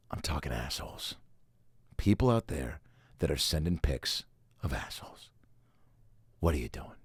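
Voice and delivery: hushed scandalised whisper. Real, not acted. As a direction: lean in and whisper it, shocked. Scandalised confiding aside.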